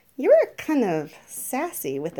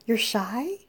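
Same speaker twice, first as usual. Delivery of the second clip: normal talking voice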